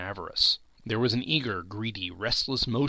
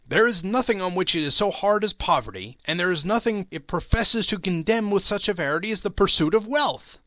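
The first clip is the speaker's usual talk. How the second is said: put-on young Scrooge arguing; 7 semitones higher, 5 dB louder than usual. A narrator's reading. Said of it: "he said".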